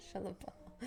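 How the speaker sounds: soft unvoiced mock retort; whisper. Real, not acted. Teasing a viewer, in a whispered joking retort. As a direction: hiss it jokingly under your breath.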